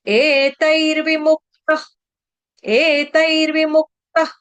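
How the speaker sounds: एतैर्विमुक्तः ending with a soft breathy kh; visarga as soft kh. Shown as a demonstration. Teacher demonstrating एतैर्विमुक्तः with visarga as kh. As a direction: say एतैर्विमुक्तः, finishing the visarga like half kh. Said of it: "उच्चारण करेंगे हलंत ख".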